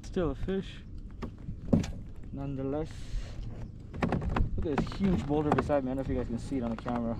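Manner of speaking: ordinary delivery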